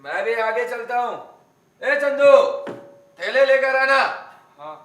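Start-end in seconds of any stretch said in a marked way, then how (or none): 1.8-4.0 calling out. Acted. Man ordering Chandu to bring bags, calling out. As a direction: call across to fetch the bags.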